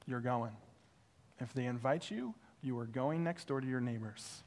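calm and final, a hint of God; quiet command. God quietly insisting the pastor go next door.

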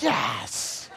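loud cheering shout; cheering yes. Retold or imitated. Neighbors cheering that the pastor will come. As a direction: whoop it out.

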